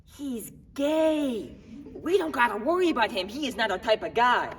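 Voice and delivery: high, loud imitation of dismissive cops; mocking quote. Suspect voicing what the cops are thinking, mocking. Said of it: "'cause now you're all thinking".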